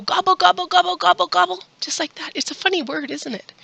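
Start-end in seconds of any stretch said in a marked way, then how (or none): 0.0-1.6 high, rapid-fire turkey gobbles; demonstrating a turkey's call for kids; turkey gobbling. Shown as a demonstration. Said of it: "Do you know what turkeys say?".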